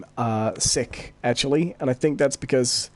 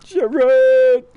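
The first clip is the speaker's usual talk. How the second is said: high, loud drawn-out Brummie call; exaggerated Brummie holler; Birmingham accent; 9 semitones higher, 6 dB louder than usual. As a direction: yell the name in a Birmingham accent. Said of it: "nobody would want the Birmingham accent".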